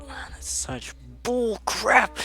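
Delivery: put-on sulky teen protest; whiny complaint. Reacting to being grounded.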